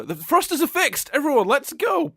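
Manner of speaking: high, loud, excited mock shout; rally everyone with hammed-up eagerness; eager announcement. Imagined Lars telling everyone to go, high eager shout.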